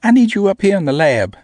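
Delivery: put-on high, loud bossy small girl. A narrator's reading. Namie calling him up to the lab, high and loud.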